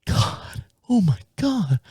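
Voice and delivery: hushed, breath-heavy aghast imitation; mimicked shock. Young mom stares at the driver, hushed mock horror. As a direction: barely voice it, like a stunned witness. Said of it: "she's looking at me like this".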